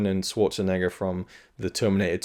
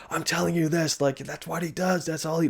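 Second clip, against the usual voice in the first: higher-pitched, urgent imitation of a hero pushing out exposition; intense Michael Biehn; 8 semitones higher than usual. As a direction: mimic a tense action hero delivering exposition under pressure. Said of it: "high pressure, intense exposition mode".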